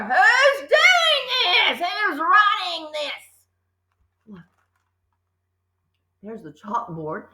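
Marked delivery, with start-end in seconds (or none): 0.0-3.2 very high, slow frantic shout; furious yell. A narrator's reading. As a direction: scream at the empty room. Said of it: "she yelled at nobody in particular".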